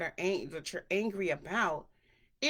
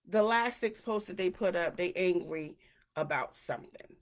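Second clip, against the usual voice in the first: normal talking voice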